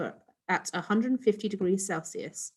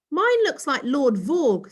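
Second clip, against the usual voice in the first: put-on higher, louder, slow proud boy; proud child; 7 semitones higher, 6 dB louder than usual. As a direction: show off your biscuit proudly. Proud child showing biscuit.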